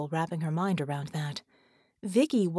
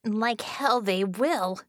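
slow, emphatic defiance; fierce, stretched-out refusal; angry snarl; 2 semitones higher, slower than usual. A narrator's reading. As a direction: snarl it with each word drawn out. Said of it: "Bella snarled".

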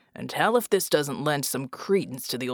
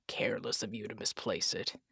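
low taunting whisper; 9 semitones lower, 7 dB quieter than usual. A narrator's reading. Mercenary needling the demon about her loss, whispered. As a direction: breathe the jab under your breath.

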